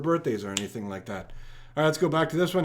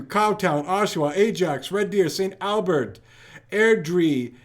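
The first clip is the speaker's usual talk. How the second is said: ordinary delivery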